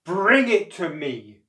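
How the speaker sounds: loud, slow, forceful demand; snapped order. A narrator's reading. Wife demanding the heart from crocodile, snapping. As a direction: snap it, leaning on every word. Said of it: "snapped his wife".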